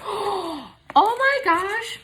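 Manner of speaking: gasp, then a slow high oh my gosh; drawn-out high delighted gasp. Real, not acted. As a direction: stretch out the surprise in a high voice. Opening gift package.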